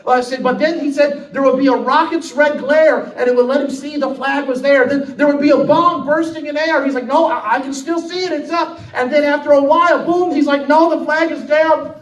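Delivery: loud, high, driving sermon delivery; preaching at full force. Acted. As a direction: preach it hard and build the fire. Preacher retells battle at full force.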